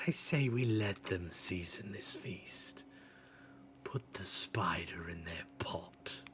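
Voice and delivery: slither the words out; sneaky whisper. Acted. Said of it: "sneaks in".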